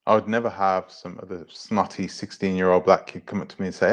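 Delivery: ordinary talk, nothing marked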